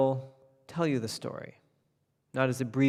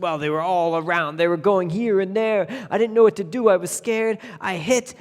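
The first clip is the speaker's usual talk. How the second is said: higher and louder as he rattles on; flustered tailor; 6 semitones higher, 6 dB louder than usual. Acted. Tailor nervously explaining his feat to the king, flustered. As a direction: babble nervously, building up.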